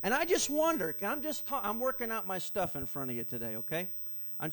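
ordinary talk, nothing marked

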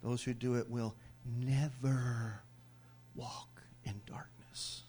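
slow, fading to a whisper; hushed. Acted. Preacher hushing the church, slow and whispered. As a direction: drop to a breathless whisper on darkness.

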